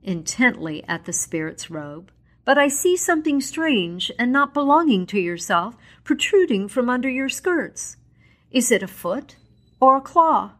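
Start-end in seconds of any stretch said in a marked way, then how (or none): 2.4-10.5 put-on higher, pleading old miser; Scrooge. Scrooge asks the ghost about the claw, higher and uneasy. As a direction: ask it nervously, voice raised high. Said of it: "said Scrooge".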